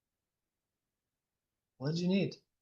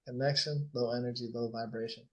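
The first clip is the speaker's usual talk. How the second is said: soft guided reflection; 2 semitones lower, 5 dB quieter than usual. Acted. Coach softly replaying Eric's lonely evening to the group, hushed. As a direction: paint the lonely scene softly for them.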